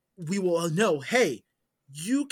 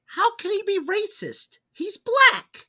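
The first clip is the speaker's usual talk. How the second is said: mother-in-law voice; 13 semitones higher, 4 dB louder, slower than usual. Retold or imitated. Mother-in-law quoted in story.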